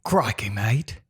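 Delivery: mock Aussie accent practice phrase; Australian accent. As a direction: try on an Australian twang. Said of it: "I need, like, a phrase to come back to".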